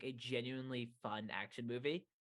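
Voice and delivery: normal talking voice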